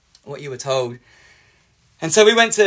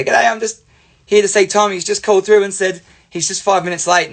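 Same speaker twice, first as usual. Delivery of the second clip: tag; 6 semitones higher, 5 dB louder than usual. Retold or imitated. Voices friends arriving at the house.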